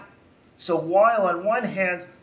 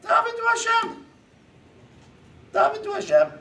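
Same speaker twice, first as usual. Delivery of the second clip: much higher, ringing call to pray to Hashem; urgent exhortation. Retold or imitated. Baal Shem Tov urging followers to pray, ringing cry. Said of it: "the Baal Shem Tov would say".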